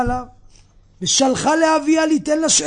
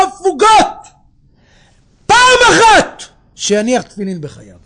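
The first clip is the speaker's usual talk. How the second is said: emphatic preaching rabbi; 5 semitones higher, 8 dB louder than usual. Acted. Voices rabbi, preaching at full force. (הכרזה נלהבת, איטית ובקול רם)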